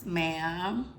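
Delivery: usual speaking voice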